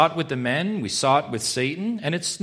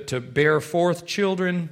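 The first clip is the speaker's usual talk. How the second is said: ordinary talk, nothing marked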